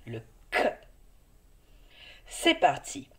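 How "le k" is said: "K" as a short hard /k/ sound; letter sound K. Shown as a demonstration. Teacher showing a child the sound of "K", as a short /k/. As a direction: say "K" as the sound /k/, not the letter name. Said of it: "il faut faire le bruit de la lettre".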